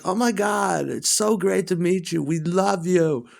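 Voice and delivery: high, gushing, starstruck squeal; mock the girlfriend fawning over a star; gushing fan girlfriend. Girlfriend gushing to [name], high and starstruck. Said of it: "then she's like".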